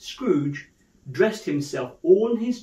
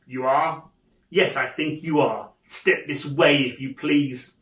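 feigned sternness; 2 semitones lower than usual. Acted. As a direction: summon the clerk with mock severity. Scrooge feigns sternness to clerk.

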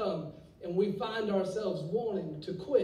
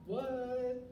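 mock shocked what; 5 semitones higher, 5 dB quieter than usual. Reacting to shocking news.

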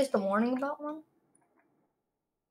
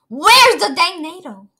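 louder, higher, drawn-out demand; impatient yell; 5 semitones higher, 10 dB louder, slower than usual. Real, not acted. Player hunting for the missing tornado, impatient yell. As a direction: yell it slowly and insistently.

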